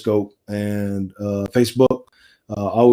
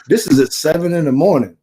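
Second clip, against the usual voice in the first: ordinary delivery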